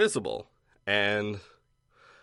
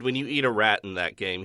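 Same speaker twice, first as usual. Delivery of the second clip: ordinary talk, nothing marked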